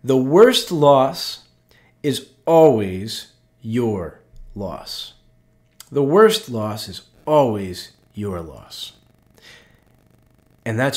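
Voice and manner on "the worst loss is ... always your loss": slow weighty quote. Retold or imitated. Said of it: "He said".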